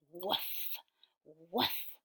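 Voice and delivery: soft little woof. A narrator's reading. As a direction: bark under your breath. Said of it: "are they being quieter?".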